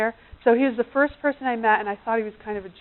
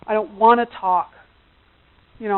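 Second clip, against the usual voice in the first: usual speaking voice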